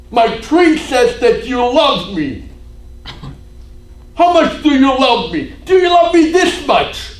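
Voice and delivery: high, loud, demanding cry at Jesus; retold taunt rising to a shout. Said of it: "she started to taunt him".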